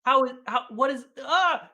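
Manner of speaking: high, stammering astonishment; amazed kid reaction. Retold or imitated. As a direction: act out a kid overwhelmed with wonder. Acting out his amazed childhood self.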